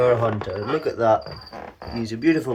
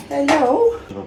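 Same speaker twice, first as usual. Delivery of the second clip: squeaky pet hello; 13 semitones higher, 4 dB louder than usual. Real, not acted. Greeting the snake fondly.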